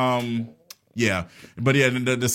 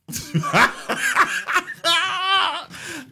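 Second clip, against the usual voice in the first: laugh. Real, not acted. Bursts out laughing mid-sentence.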